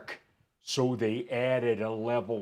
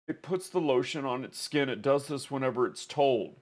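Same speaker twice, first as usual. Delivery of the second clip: Buffalo Bill voice; 4 semitones higher than usual. Retold or imitated. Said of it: "That's not my Buffalo Bill voice".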